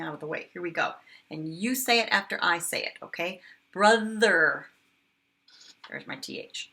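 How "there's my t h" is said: whispered, all air and no voice; just breathe the words out; breathy whisper. Shown as a demonstration. Teacher showing the breathy T H, whispered. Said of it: "these are all the breathy ones".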